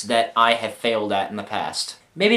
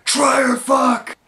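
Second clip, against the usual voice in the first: high-pitched full shout; yelled out; 13 semitones higher, 3 dB louder than usual. Retold or imitated. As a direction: blurt it out at a yell. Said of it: "instead I yelled out".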